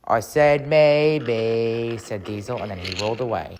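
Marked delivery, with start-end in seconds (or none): 0.1-2.0 very slow, drawn-out teasing reply; coy taunt. Acted. Engine answering Toby's accusation, coy drawn-out taunt. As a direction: stretch the final word out slyly.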